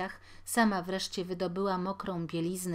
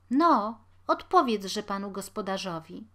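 interjecting wife; 2 semitones higher, 7 dB louder than usual. A narrator's reading. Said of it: "wtrąciła żona".

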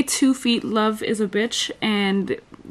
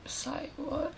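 soft, higher Australian-accented "Say what"; 4 semitones higher, 14 dB quieter than usual. Shown as a demonstration. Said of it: "debating about this Australian accent".

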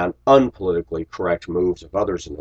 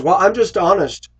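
high, louder mock of a blunt coworker; imitating coworker; 12 semitones higher, 6 dB louder than usual. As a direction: toss it off like the callous coworker. Coworker voiced in retold quarrel.

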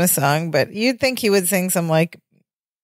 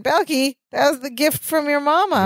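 surprised blurt; 8 semitones higher than usual. Retold or imitated. Can't contain his thoughts.

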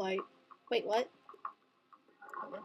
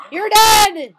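gleeful shout; 6 semitones higher, 14 dB louder than usual. Real, not acted. Shark attacking swimmers.

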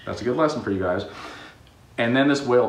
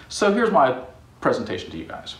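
normal talking voice

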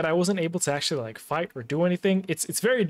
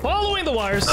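much higher, excited call; high excited repeat; 10 semitones higher, 3 dB louder than usual. Real, not acted. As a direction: pipe it up high, falling at the end. Repeats it excitedly while playing.

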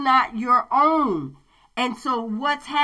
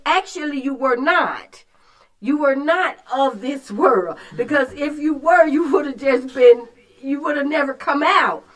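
loud, high fervent preaching; 4 semitones higher, 4 dB louder than usual. Acted. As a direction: preach it out with rising fire. Preacher builds to full force.

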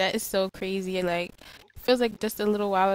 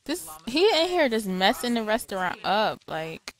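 ordinary delivery

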